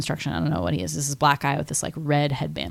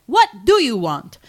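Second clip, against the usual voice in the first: loud, moderately high character voice; other stranger's version; 6 semitones higher, 5 dB louder than usual. Retold or imitated. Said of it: "the other guy's like".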